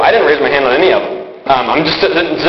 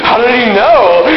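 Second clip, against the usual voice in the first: high, startled disbelief; astonished; 7 semitones higher, 3 dB louder than usual. Retold or imitated. Boy in church, stunned the preacher singled him out. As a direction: gasp out the question in amazement.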